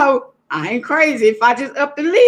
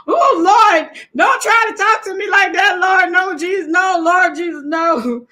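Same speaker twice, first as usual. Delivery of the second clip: high, louder alarmed pleading to the Lord; half-laughing panic at a spooky voice; mock-frightened crying out to Jesus; startled cry; 6 semitones higher, 3 dB louder than usual. Real, not acted. Scared by deep voice, laughing.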